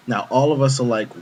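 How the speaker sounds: ordinary delivery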